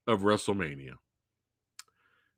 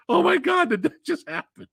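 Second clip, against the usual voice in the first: retold scream; 14 semitones higher than usual. Host retelling watching the injury with a friend, mock screaming. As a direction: re-enact stunned disbelief in a high voice. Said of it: "We were screaming".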